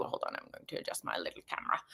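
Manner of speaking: fast, breathy under-the-breath aside; whispered aside. Real, not acted. Host whispers to herself while adjusting the camera, hushed. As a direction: mutter it to yourself as you fiddle.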